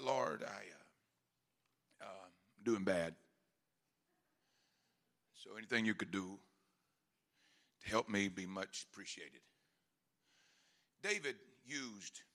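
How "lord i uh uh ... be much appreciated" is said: low, soft, mumbled mock prayer, lazily tossed off; a limp, offhand plea to God, much softer and lower; half-hearted prayer. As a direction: pray like you can barely be bothered. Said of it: "he wouldn't just say".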